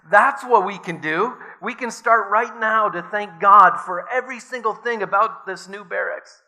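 Betsy can hardly contain her joy; elated. A narrator's reading. Betsy telling Corrie to thank God for everything, elated. Betsy's joyful discovery in barracks.

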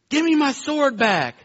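high-pitched impression of a boy at play; imitating playing child. Son yelling at his brother in morning play, imitated child voice. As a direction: mimic a kid demanding a toy back. Sons playing noisily wake him up.

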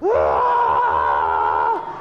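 low, loud mock shriek; hammed-up child's scream; imitated banshee scream. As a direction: belt out a retold wail. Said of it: "banshee-esque scream".

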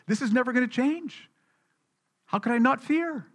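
high-pitched acted worry; fearful. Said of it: "You can become so fearful, it's like".

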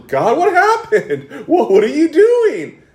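high-pitched mock protest; bewildered Moses. Moses to God at the end of chapter five, bewildered. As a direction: question God in an acted, incredulous complaint.

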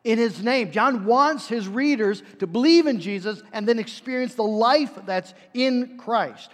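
ordinary talk

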